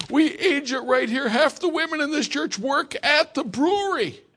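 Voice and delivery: mock-defend local pride at full volume; imitating an outraged deacon. Deacon defending Kentucky bourbon.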